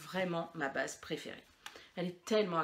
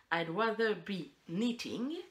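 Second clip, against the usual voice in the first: slow, careful English with a French accent; slower than usual. Shown as a demonstration. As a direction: read the badge in English, slowly. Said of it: "Vous avez vu cet accent de ouf". (anglais lu lentement avec un accent)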